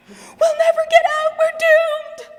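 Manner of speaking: high, loud panicked wail; panicked frog. Acted. Frog stuck in cream, panicking, high panicked wail. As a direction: shriek it like a frog who thinks it's all over. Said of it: "began to panic".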